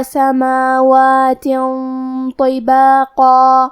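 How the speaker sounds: اطوارا chanted very slowly, full heavy ta with qalqala; heavy ta recitation. Shown as a demonstration. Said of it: "بھاری آواز میں اور منہ پھلا کے پڑھیں گے". (اطوارا بھاری طا، قلقلہ کے ساتھ آہستہ تلاوت)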